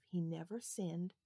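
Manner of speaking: ordinary delivery, nothing marked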